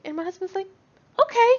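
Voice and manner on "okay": a sudden high loud okay. Retold or imitated. Husband giving in to son, high loud okay. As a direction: give in with a bright loud okay. Husband caving, voiced by wife.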